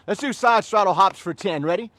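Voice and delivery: loud drill-instructor count; yelling. Real, not acted. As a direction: call out the exercise loudly over a class. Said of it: "yell so loud".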